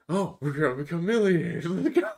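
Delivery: overeager, laughing at his younger self; mock hype. Mocking his naive younger self.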